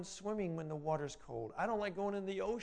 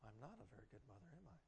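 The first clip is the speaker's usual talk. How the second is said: quick, soft, defeated murmur; Linda's self-doubt; 6 semitones lower, 18 dB quieter, much faster than usual. Retold or imitated. Linda sitting with her daughter trouble, quick deflated murmur. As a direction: say it fast and low like she believes it. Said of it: "she sits there and says".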